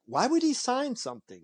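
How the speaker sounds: retold disbelief. Fan told to go get an autograph, disbelieving. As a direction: question it as if it could never happen.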